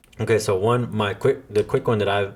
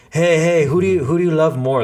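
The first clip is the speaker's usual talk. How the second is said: higher, louder mock party-goer voice; tipsy acquaintances; 7 semitones higher, 4 dB louder than usual. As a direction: play the rowdy drunk friends.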